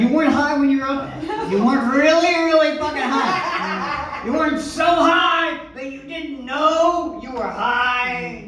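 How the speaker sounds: loud, slow, laughing accusation; teasing shout. Real, not acted. Host ribbing the guest about writing stoned, loud and laughing. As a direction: call him out as if busted.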